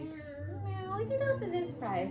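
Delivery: high-pitched caricature of a haggling seller; fabric store staff. Retold or imitated. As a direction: imitate the store's counteroffer in a squeaky put-on voice. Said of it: "And they were like".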